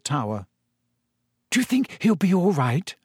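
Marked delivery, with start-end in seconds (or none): 1.5-2.9 nervous. A narrator's reading. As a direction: ask with a nervous edge. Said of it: "said Hermione nervously".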